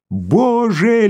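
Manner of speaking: high exultant exclamation; jubilant cry. Acted. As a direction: exclaim with pure joy. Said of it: "ликуя воскликнул Коротков". (ликующий возглас)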